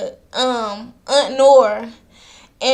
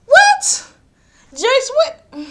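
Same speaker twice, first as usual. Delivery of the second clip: incredulous what; 13 semitones higher, 5 dB louder, much slower than usual. Retold or imitated. Mock outraged reaction to Joyce.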